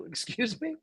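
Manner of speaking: high, soft incredulous query; retold baffled little question; taken aback. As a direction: ask it in hushed, high disbelief. Retold baffled reaction to nonsense word.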